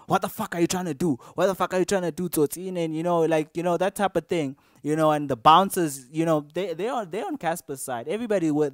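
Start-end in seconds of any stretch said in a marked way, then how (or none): 0.1-2.8 imitating a heated shout, pitched up and fast; retold angry yelling. Osmic confronting the speaker backstage, mock angry yell. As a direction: replay his angry challenge, higher and quicker. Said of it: "he turns up on me on some".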